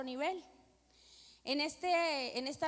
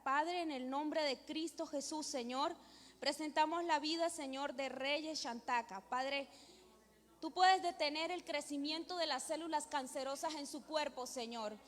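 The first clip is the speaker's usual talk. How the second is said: pleading prayer building louder and higher; fervent prayer; 3 semitones higher, 4 dB louder than usual. Real, not acted. Woman praying to God for a sick child's healing, fervent building prayer. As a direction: pray aloud with mounting force, phrase on phrase.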